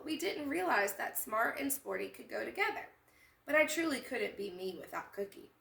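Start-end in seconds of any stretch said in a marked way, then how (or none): none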